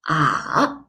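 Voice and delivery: "ah" dipping low, then rising; falling-rising tone. Shown as a demonstration. Teacher showing third tone, "ah" down then up. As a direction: drop "ah" down, then lift it. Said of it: "goes down first and then rises up".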